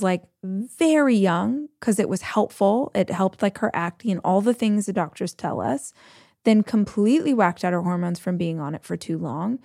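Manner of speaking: ordinary talk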